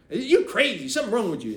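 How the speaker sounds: put-on high, loud teen girl scoffing; sassy teen retort. Niece telling him he's crazy, high and loud. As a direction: fling it at him like a teenager.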